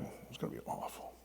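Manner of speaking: unvoiced whispered dread; hushed dread. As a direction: whisper it like she feared the worst. Imagining the daughter's dread.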